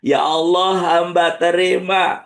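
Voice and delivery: slow, earnest acceptance prayer; measured accepting prayer. Acted. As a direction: lead the words of surrender.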